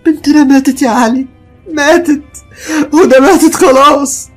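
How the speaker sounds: very high, loud, broken wail; wailing grief. Acted. Mother wailing to Ali that their daughter is dead. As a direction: mourn the child, keening. Wife wails daughter died.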